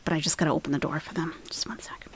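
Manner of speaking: fast whispered aside; whispering. Real, not acted. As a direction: hurry it under your breath. Aside while opening door.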